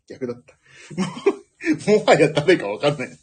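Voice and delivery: usual speaking voice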